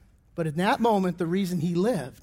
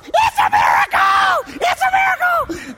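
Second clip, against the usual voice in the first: ecstatic family shouting; 8 dB louder than usual. Retold or imitated. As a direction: cry out a miracle at the top of your voice. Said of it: "The family's going".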